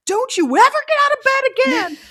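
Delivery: high, loud mom yell; exasperated scolding. Retold or imitated. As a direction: act out a fed-up parent's shouted order. Exasperated mom scolds third child.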